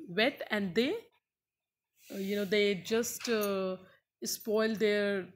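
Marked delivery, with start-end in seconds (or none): none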